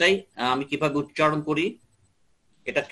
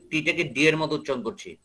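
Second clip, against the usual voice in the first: ordinary talk, nothing marked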